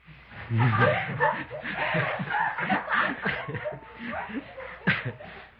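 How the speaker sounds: long high-pitched burst of laughter; laughing. Real, not acted. Speaker amused by his own drunk-father story, laughing. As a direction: break into a long, helpless laugh.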